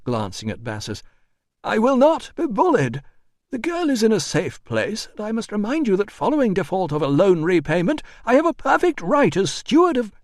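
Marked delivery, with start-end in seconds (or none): 1.6-10.1 high, loud, officious protest; indignant administrator. A narrator's reading. Administrator refusing to be bullied, high and indignant. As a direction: stand on your rights, pitched high.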